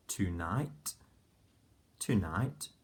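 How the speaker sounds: normal talking voice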